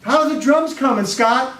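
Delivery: bright, chirpy teacher checking in; music teacher greeting the boy in the hall; cheery music teacher. Retold or imitated. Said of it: "and says".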